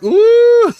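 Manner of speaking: very high swooning "ooh"; crowd ooh. Retold or imitated. Imitating the moved crowd, high swooning ooh. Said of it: "they're all like".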